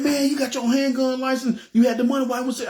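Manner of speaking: high-pitched agitated questioning, acted; upset disbelief. Driver questioning friend about the refused bullets, upset. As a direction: imitate an upset friend demanding answers. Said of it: "like, physically upset".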